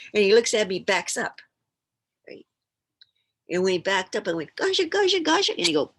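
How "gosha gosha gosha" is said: high, loud cooing to an animal; high-pitched pet coaxing. Retold or imitated. Child greeting a skunk like a kitty, high coaxing. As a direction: squeak it like a kid calling kitty.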